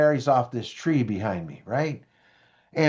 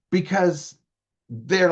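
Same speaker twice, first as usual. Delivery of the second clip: ordinary talk, nothing marked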